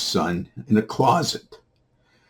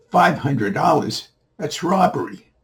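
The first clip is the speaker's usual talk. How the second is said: high, incredulous echo of the price; acted outrage; 8 semitones higher, 4 dB louder than usual. Man balking at the boy's price, incredulous. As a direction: pitch it up in indignant disbelief.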